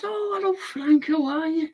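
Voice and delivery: high, soft mock lady voice; lightly voiced posh greeting; imitating her. Husband's wife greeting the narrator, high sweet voice. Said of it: "And she said".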